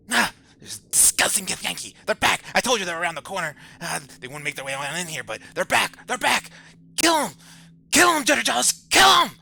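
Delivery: a frenzied head shrieking at intruders; panicked screaming. Acted. Head spots Githyanki, screams.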